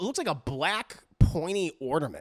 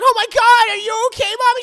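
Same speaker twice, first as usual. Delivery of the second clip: much higher and louder worried cry; panicked friends; 12 dB louder, faster than usual. Retold or imitated. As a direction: shriek it in alarm for her. Friends frightened for Mommy.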